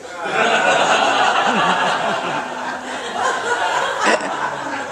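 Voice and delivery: loud breathy high cackle at own joke; big high laugh. Real, not acted. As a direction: burst out laughing, high and airy.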